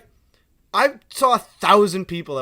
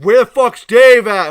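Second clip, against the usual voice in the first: loud, raised-pitch heckler yell; belligerent shout; 7 semitones higher, 16 dB louder than usual. Retold or imitated. Rude customer demanding Dave from the back, shouting angrily. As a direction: imitate a rude customer bellowing. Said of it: "either drunk or just born an asshole".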